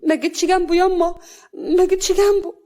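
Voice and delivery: high, loud protest of innocence; pleading denial. A narrator's reading. Accused woman denies in a quarrel.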